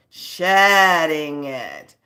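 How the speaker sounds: slow emphatic pronouncement; dramatic drawn out. Real, not acted. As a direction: stretch it out for effect. Dramatic tarot reading.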